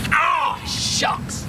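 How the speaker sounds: high, strained cry of pain; sudden hurt outburst; pained yelp. Real, not acted. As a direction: yelp as the knee gives. Got hurt, yelps.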